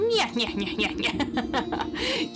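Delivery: impish mocking cackle; pixie cackle. Pixie laughing at the witch, impish cackle. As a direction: cackle like a trickster sprite.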